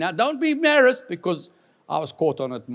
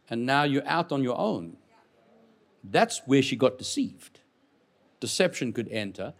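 ordinary talk, nothing marked

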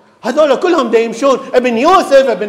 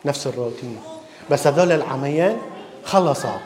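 normal talking voice

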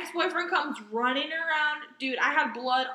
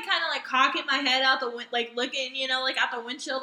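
normal talking voice

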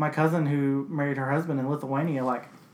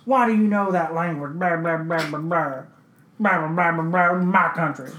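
hammed-up angry grumbling, louder; mock indignant rant; 4 dB louder than usual. Mimicking locals upset you know their language, mock indignant. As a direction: imitate a locals' tirade in garbled blahs.

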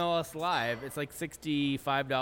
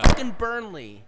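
frustrated outburst; 5 semitones higher, 12 dB louder than usual. Real, not acted. Frustrated rant about team.

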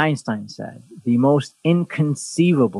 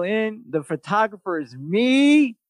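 normal talking voice

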